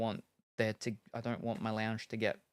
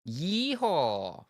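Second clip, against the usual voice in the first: high, loud mock cowboy whoop; playful yeehaw call; 6 semitones higher, 4 dB louder than usual.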